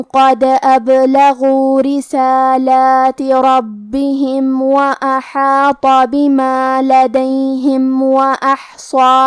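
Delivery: slow, melodic tajweed chanting; Quran recitation. Acted. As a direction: chant each verse slowly, stretching the vowels. Quran recitation beside lesson. (آہستہ، سُریلی تلاوت)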